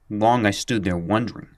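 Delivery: plain reading voice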